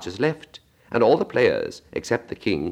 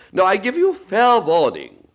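much higher, louder, slow furious Queen; Queen shouting; 13 semitones higher, 6 dB louder, slower than usual. Acted. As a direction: bellow a royal threat. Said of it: "shouted the Queen".

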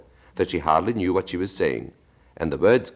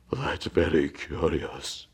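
slow Mock Turtle voice; 4 dB quieter, much slower than usual. Long breath first.

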